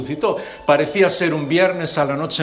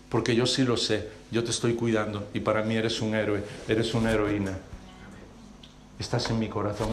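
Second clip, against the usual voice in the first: softer, lower loving reassurance; tender whisper-soft; 4 semitones lower, 6 dB quieter than usual. Acted. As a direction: say it close, heart to heart. God's tender closing words. (ternura suave y grave)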